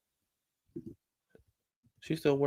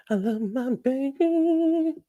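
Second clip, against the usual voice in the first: much higher, slow cooing; much slower than usual. Real, not acted. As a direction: coo it slowly to your little one. Coos to pet or baby.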